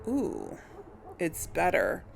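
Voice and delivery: ordinary talk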